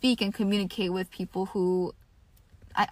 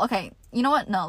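ordinary delivery

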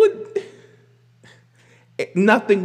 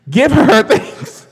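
much louder, slower, laughing into the mic; loud laughing praise; 8 semitones higher, 10 dB louder, slower than usual. Real, not acted. Demanding credit for [name], belted into the mic laughing. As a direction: lean into the mic and belt it through a laugh. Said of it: "let me get to the mic".

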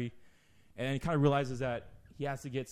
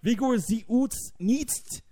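very slow, high, loud fake German; mock German; 9 semitones higher, 7 dB louder, much slower than usual. The German guy answering the boys, in slow hammed-up mock German. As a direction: drag out the gibberish in a hammed-up German accent. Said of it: "the German guy says something like".

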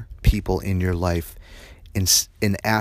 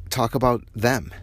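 usual speaking voice

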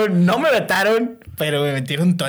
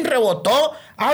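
ordinary talk, nothing marked

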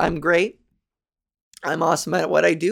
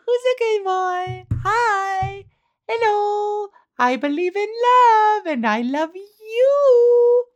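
high cooing pet voice, swelling louder; dog baby talk; 13 semitones higher, 3 dB louder, slower than usual. Pretend. Cooing to the listeners as if to a pet dog, high and gushing. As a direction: gush at a puppy, ending slow and teary.

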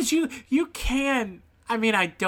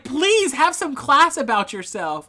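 mock stern judge; 7 semitones higher, 8 dB louder than usual. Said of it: "the judge had to be like".

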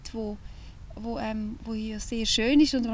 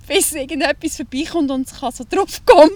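loud laugh spilling into the words; laughing while talking; 5 semitones higher, 13 dB louder than usual. Real, not acted. Laughing loudly while picturing the beast. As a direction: talk through a fit of laughter.

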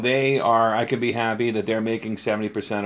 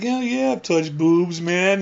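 put-on higher, slow boastful kid voice; fake bravado; 9 semitones higher, slower than usual. Man replays his younger self bluffing to boys. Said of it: "I'm like".

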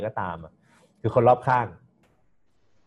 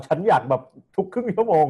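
eager; 7 semitones higher, 5 dB louder than usual. Retold or imitated. Quoting an eager eater.

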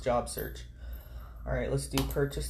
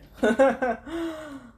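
high laugh; 12 semitones higher, 7 dB louder than usual. Real, not acted. Laughs at own joke.

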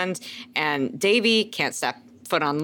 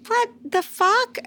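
very high, drawn-out disbelief; high-pitched slow outrage; incredulous; slower than usual. Real, not acted. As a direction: stretch it out slowly in a high, incredulous squeal. Exasperated at a character's behavior.

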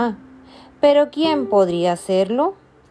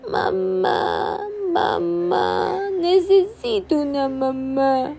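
much higher, wailing for mama; crying little bird; 9 semitones higher, much slower than usual. Acted. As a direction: cry out for mama, tearful. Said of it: "empezó a llorar". (llorando, muy agudo)

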